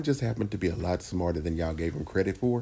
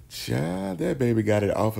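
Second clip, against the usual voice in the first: sassy aside; 5 semitones higher than usual. Real, not acted. Sassy aside after laughing.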